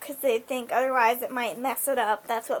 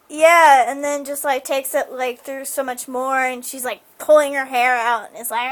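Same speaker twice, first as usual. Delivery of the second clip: ordinary talk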